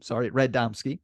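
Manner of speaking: ordinary delivery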